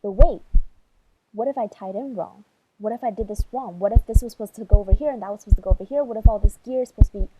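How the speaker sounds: loud, fast, spiralling panic; panicking. Real, not acted. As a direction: rush the doubts out in mounting fear. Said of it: "I started to panic".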